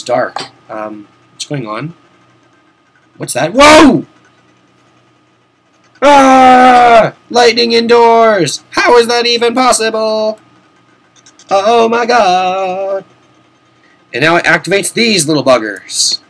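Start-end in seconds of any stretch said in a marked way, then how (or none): 3.5-13.0 loud high-pitched amazed yelling; lightning indoors, wow; amazed shout. Real, not acted. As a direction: shout out in astonishment.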